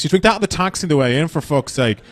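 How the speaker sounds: ordinary delivery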